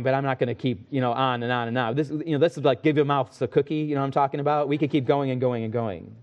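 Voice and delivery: ordinary delivery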